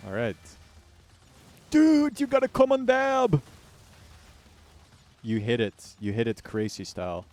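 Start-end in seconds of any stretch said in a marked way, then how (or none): 1.7-3.4 excited shout. Real, not acted. Hyped call-out.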